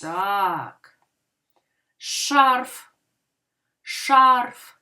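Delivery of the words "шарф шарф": Шарф as one strong, held beat; stressed single syllable. Shown as a demonstration. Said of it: "і тому він".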